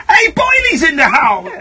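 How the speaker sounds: loud, laughing welcome shout; excited shout. Real, not acted. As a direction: hail a friend arriving, loud and happy. Calling out a guest's arrival.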